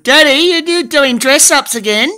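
high, loud questioning pet voice; pet's imagined voice. Acted. Pet teasing Daddy about dress-ups, high and loud imagined voice. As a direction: voice the pet calling out to Daddy, pitched right up. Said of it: "You think".

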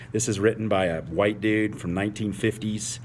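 normal talking voice

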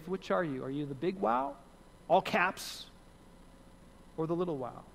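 normal talking voice

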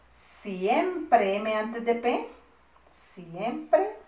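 slow, syllable by syllable; drawn-out dictation with rising ends. Shown as a demonstration. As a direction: stretch the word for pupils copying. Teacher dictating with spelling rule.

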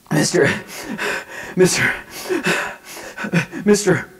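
halting imitation sobs, gasping between words; retold sobbing. Boy trying to say his dog died, imitated sobbing. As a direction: imitate a child getting words out through tears. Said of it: "He's starting to hear".

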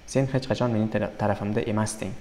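ordinary talk, nothing marked

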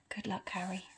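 very soft, tender murmur. Acted. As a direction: murmur it gently to the sleeping baby. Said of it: "he murmured".

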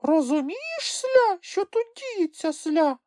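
very high, tiny squeaky voice; squeaky small creature question; puzzled little creature. A narrator's reading. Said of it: "запитала Чупсля".

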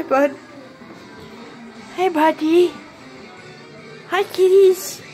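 ordinary talk, nothing marked

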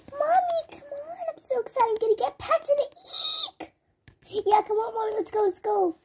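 put-on pleading little girl tugging at mom; excited little kid. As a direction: pretend to be a kid bouncing to leave. Child figure begging mother.

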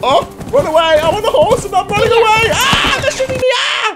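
high, frantic yelps while fleeing on the horse; panicked screaming. Real, not acted. Fleeing gunfire on the horse, panicked screaming. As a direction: yell in real panic as bullets fly.

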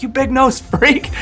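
mock heckler.

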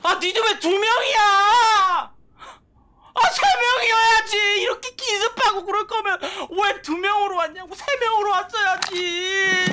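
high whining protest; whiny crying complaint. Real, not acted. Ambushed by enemies, whining.

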